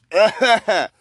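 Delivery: loud high spooky laugh; ghost cackle. Acted. Ghost cackling at the miser.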